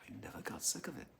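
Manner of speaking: quick, very soft whisper; whispering, as if confiding; whispered repeat. Real, not acted. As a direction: echo it in a whisper.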